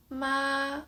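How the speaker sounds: "ma" high, long and level; first tone. Shown as a demonstration. Teacher compares the tones, "ma" high and flat. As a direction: hold "ma" flat on a high note. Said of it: "The first tone".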